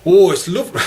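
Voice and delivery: high, loud Newcastle accent; attempted Geordie. Shown as a demonstration. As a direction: launch into a mock Geordie lilt.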